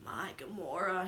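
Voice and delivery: a hushed, breathy "My Gamora"; whispered. Retold or imitated. Star-Lord asking after Gamora, whispered. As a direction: breathe it like a worried secret.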